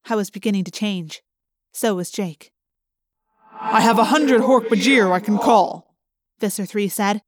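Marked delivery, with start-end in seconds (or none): 3.5-6.0 menacing villain. A narrator's reading. Villain threatens, loud and far.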